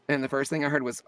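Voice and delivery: ordinary talk, nothing marked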